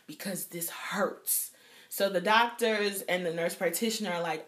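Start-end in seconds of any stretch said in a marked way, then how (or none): 0.1-1.5 unvoiced whisper through the pain; patient whispering that it hurts. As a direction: whisper it, hurting. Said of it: "I said".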